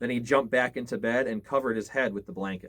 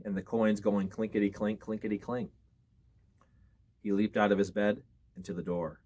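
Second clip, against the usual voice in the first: ordinary delivery, nothing marked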